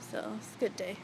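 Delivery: ordinary talk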